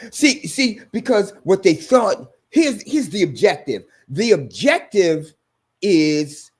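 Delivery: high, loud preaching at full force; fiery preaching. Real, not acted. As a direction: preach it out to the congregation.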